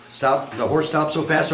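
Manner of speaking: normal talking voice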